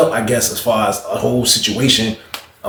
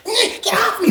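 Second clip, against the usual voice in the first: panicked yell; 3 dB quieter than usual. Retold or imitated. Victim yelling.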